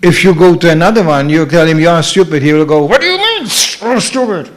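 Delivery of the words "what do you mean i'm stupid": high, explosive mock outrage; angry Kshatriya. Warrior type called stupid, mock angry outburst. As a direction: flare up at the insult. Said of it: "Well, that's a Kshatriya".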